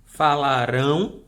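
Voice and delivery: "Falarão" slow and loud, with weight on the final syllable; last syllable stressed. Shown as a demonstration. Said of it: "sílaba um". ("Falarão" com a última sílaba forte)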